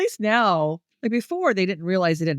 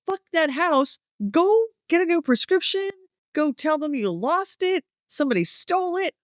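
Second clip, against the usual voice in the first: high-pitched exasperated outburst; exasperated rant; 9 semitones higher than usual. Retold or imitated. Viewer telling off the character over the medicine, exasperated. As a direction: rant it like fed-up advice yelled at the screen. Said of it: "I was like".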